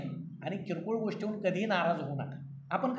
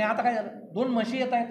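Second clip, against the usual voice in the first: usual speaking voice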